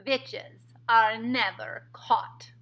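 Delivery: very slow, loud, menacing witch voice; Grand High Witch snapping. A narrator's reading. Grand High Witch rebuking her audience, slow and loud. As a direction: spit each word out like a tyrant. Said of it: "snapped the Grand High Witch".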